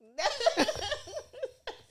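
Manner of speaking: loud, high laugh. Real, not acted. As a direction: crack up at being teased. Laughs at being offered tissue.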